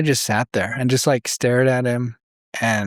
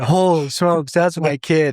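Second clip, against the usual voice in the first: higher, excited disbelief; a retold burst of new-father wonder; amazed new dad; 4 semitones higher than usual. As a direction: gush it like you can't believe he's yours. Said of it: "Of, like".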